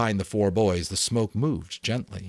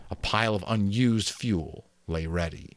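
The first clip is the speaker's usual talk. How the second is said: ordinary delivery, nothing marked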